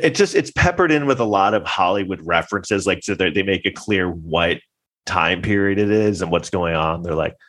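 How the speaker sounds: usual speaking voice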